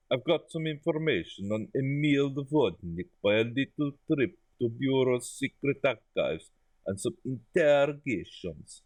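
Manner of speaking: drifting, put-on Eastern European accent; evolving foreign accent. Boss sharing information on Vodnik, thickening foreign accent. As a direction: let the foreign accent thicken as you read. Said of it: "I think this accent's evolving as we go".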